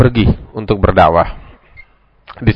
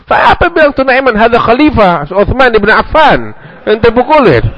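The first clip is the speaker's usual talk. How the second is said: horrified reveal; 10 semitones higher than usual. Retold or imitated. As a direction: tell him who he really hit, aghast. Crowd reveals victim is Khalifah.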